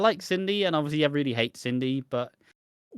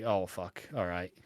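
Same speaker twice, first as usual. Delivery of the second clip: lower, softer reluctant concession; resigned murmur; 7 semitones lower, 9 dB quieter than usual. Retold or imitated. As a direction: give in quietly. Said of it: "you are like".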